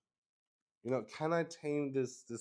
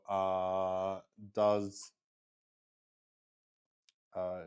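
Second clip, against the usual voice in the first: usual speaking voice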